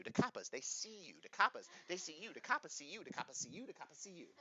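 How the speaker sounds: a taunt that dies away each time; fading teasing refrain. Pretend.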